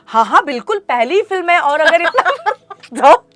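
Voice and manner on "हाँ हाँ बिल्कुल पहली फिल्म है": eager agreement. Retold or imitated. Eager naive agreement, louder.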